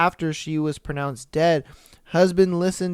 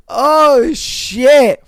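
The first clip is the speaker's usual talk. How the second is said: shocked exclamation; 8 semitones higher, 10 dB louder than usual. Real, not acted. Shocked by poisoning twist.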